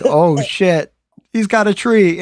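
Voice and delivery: high-pitched, loud comic panic; mock alarm. Storyteller reliving the sight of the tree, high mock alarm. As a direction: yelp it in exaggerated shock. Acting out the retold shock.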